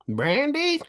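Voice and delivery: high mock mother calling from the porch; imitating her mom. Mimicking girlfriend's mom calling her in from the porch, high and teasing. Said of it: "her mama flicked the porch light".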